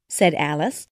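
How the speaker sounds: ordinary delivery, nothing marked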